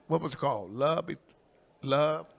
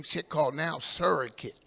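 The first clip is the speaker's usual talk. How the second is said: ordinary talk, nothing marked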